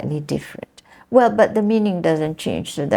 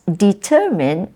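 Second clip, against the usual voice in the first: "determine" as de-TER-mine; stress second syllable; 2 semitones higher than usual. Shown as a demonstration. Teacher models the correct word stress, stress second syllable. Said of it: "stress is on the second syllable".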